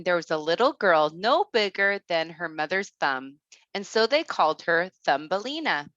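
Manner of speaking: ordinary delivery, nothing marked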